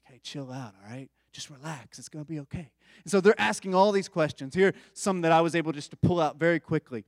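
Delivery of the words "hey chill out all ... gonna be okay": soft, hushed calming of an anxious friend. Retold or imitated. Preacher soothing worried friends, in a soft hush. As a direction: settle them down gently, much softer.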